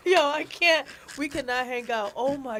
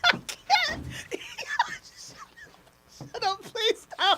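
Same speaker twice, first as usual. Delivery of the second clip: breathless laughing plea; laughing helplessly; 9 semitones higher, 6 dB quieter than usual. Real, not acted. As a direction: beg them to stop, laughing. Guest laughing, pleading stop.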